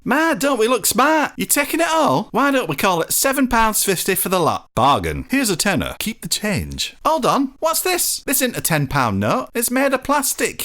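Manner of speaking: high, hearty shop-counter welcome; cheery northern shopkeeper. Acted. Shopkeeper greeting wet strangers, cheery northern voice. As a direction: greet the lads like a jolly shopkeeper.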